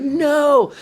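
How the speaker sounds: high, drawn-out mock crowd cry; crowd's protest. School crowd refusing to have the kid removed, high mock protest. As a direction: answer like a whole audience. Voicing crowd answering him.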